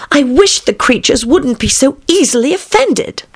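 put-on high girlish Alice voice; wistful Alice. A narrator's reading. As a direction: wish it plaintively to yourself. Alice thinks to herself.

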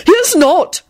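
high, outraged retort; outraged yell. A narrator's reading. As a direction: jump in with indignant denial. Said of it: "Neville yelled with outrage".

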